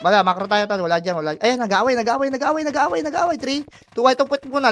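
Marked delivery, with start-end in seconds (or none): 1.4-4.7 rising, excited alert to the team; excited callout. Real, not acted. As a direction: pitch climbing higher with excitement.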